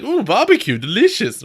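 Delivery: mock delighted. Kids charmed by barbecue.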